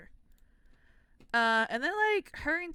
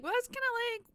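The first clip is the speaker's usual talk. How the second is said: mock skeptic; 10 semitones higher, faster than usual. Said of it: "which you might be like".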